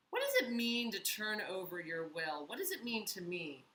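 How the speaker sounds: usual speaking voice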